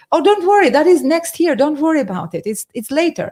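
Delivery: high, loud, casual dismissal; breezy reassuring accountant. Retold or imitated. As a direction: brush it off lightly. Retold accountant brushing off worry.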